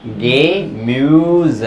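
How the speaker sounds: गेम्यूज said very slowly as ge-müs; German pronunciation. Shown as a demonstration. Tutor slowly models गेम्यूज for students. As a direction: stretch गेम्यूज out, half e then umlaut ü. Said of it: "उसका ए का sound तो बोलो".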